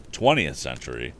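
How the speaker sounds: ordinary delivery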